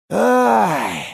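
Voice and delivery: long drawn-out "ай", high; drawn-out sigh. A narrator's reading. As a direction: drag the "ай" out wearily. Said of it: "протянул Николаевич".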